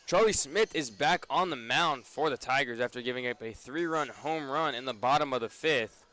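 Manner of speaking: ordinary talk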